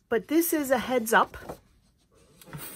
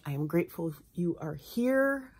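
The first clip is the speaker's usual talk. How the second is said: ordinary talk, nothing marked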